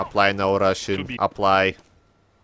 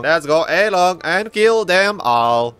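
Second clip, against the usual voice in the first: rallying call; 7 semitones higher, 6 dB louder than usual. Acted. Rallying team to attack.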